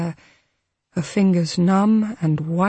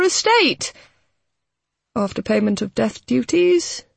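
hesitant lawyer; 9 semitones higher, 3 dB louder than usual. A narrator's reading. Lawyer reluctantly reads will.

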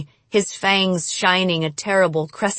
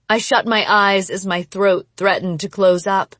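plain reading voice

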